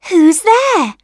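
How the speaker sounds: high, loud little questioning voice; rosebud calling out. A narrator's reading. Rosebud to tapper on window, high loud question. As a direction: call it out as a small rosebud. Rosebud asks who rustles.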